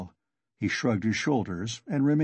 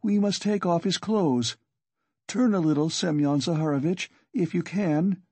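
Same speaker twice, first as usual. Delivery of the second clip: mild cry in a higher pitch; urgent cry; 6 semitones higher than usual. A narrator's reading. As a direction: call to the injured man, pitched up but not shouted. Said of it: "she cried to him".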